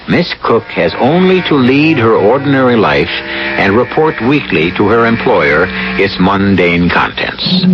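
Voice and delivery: usual speaking voice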